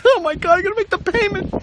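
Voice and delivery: high, squeaky retold alarm; hammed-up dismay; mock panic. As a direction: imitate comic panic at a high pitch. Retold panic over car payment.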